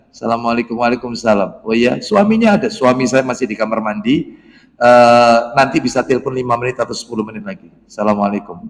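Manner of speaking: a hint of a polite wife, brief and soft; wife on the phone. Wife telling a male caller her husband is busy, softly.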